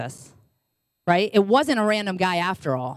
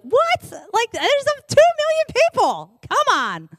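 high-pitched exasperated disbelief; mock incredulous; 14 semitones higher than usual. Frank sent to find one man among millions, mock incredulous protest. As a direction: protest the absurd errand, voice way up.

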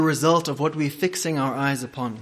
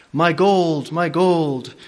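miser crying out; 2 semitones higher, 4 dB louder, much slower than usual. Acted. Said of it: "cried the miser wildly".

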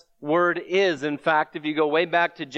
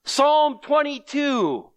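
slow, much higher urgent call; yelling out; 8 semitones higher, slower than usual. Retold or imitated. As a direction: cry it out slowly, pitch raised high. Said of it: "is yelling out".

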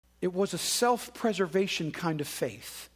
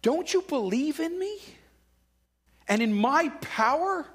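rebuke; 6 semitones higher than usual. Acted. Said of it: "Jesus' rebuke is basically saying".

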